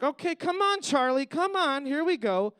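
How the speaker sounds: a hint of a mom calling her dog; coaxing mom. Comic voicing the mom leading her daughter on a leash, lightly coaxing.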